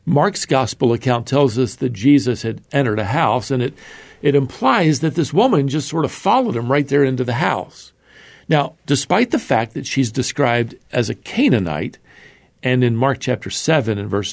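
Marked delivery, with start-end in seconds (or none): none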